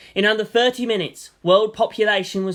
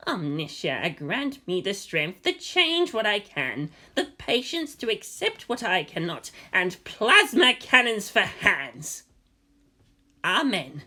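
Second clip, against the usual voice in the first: slow, hushed mock-solemn prayer; 3 semitones higher, slower than usual. A narrator's reading. Kensuke kneeling with a chainsword, slow hushed prayer. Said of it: "He prayed".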